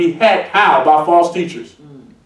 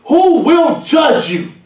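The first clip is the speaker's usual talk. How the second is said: high, slowed shout; preacher calling ministers to account; preaching shout; 6 semitones higher, slower than usual. Real, not acted. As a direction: ring out the warning, pitched up high. Preacher warns of judgment.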